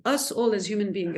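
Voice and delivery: ordinary delivery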